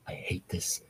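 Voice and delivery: breathy whisper; whispered cringe. Real, not acted. Reader cringing at the fanfic, whispering. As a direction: hiss it under your breath in disgust.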